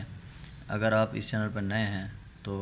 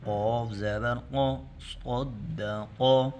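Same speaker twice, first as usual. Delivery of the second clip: slow drawn-out syllable recitation; demonstration reading; much slower than usual. Shown as a demonstration. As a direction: model the reading for the pupils. Teacher recites the spelling drill. (ہجے پڑھ کر دکھانا)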